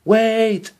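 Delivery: high loud call after her. A narrator's reading. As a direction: shout after her to stop. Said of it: "called the prince".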